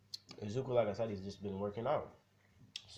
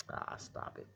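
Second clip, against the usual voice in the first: whispered, softer "Ah, stop it"; blushing whisper; 7 dB quieter than usual. Retold or imitated. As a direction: whisper it, embarrassed by the praise. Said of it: "he's kind of blushing".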